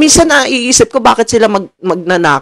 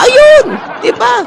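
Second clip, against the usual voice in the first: excited exclaim; slower than usual. Real, not acted. Triumphant punchline exclamation.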